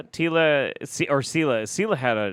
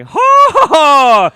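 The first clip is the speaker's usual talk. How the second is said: hammed-up shouted ho ho ho, much higher and louder; mock Irish laugh; 17 dB louder, much slower than usual. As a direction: impersonate the Romulan general's boisterous laugh. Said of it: "he'd show up ... and be like".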